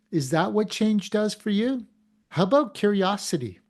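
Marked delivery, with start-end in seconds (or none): none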